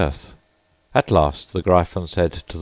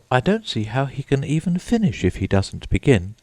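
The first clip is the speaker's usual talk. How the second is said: put-on raised girlish voice for Alice; Alice's thoughts; 8 semitones higher than usual. A narrator's reading. Alice thinking to herself, in a lifted young voice. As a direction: voice Alice's private musing, pitched up. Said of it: "Alice thought to herself".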